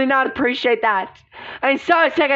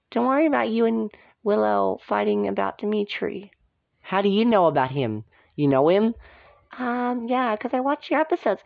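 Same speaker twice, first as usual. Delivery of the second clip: ordinary delivery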